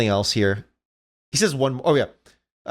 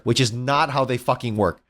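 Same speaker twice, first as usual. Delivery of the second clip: ordinary delivery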